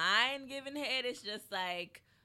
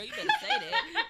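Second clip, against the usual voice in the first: excited approval. Real, not acted.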